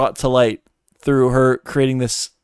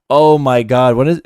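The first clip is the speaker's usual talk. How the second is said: usual speaking voice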